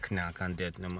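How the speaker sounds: usual speaking voice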